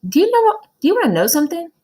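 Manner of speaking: very high, loud whisper-urgent gossip; eager boy. Acted. Jason telling Tom a secret, high and loud. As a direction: blurt the secret excitedly. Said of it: "He said".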